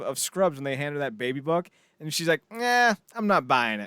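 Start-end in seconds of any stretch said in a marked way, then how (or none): 2.5-3.9 imitated dismissal. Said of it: "Like Jordan, that episode of".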